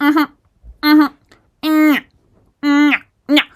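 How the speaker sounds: loud, high mock listening noises; official on phone. Official half-listens on the phone, mocking hums. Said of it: "he's on that phone and he's like".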